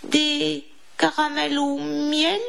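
high-pitched, tearful mouse stutter; frightened stammering. A narrator's reading. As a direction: stammer out each offer as a timid question. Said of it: "balbutia Didi".

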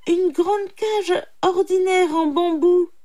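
high, loud, emphatic explanation; poor man clarifying. A narrator's reading. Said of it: "précisa le pauvre homme". (pauvre homme qui précise, voix aiguë)

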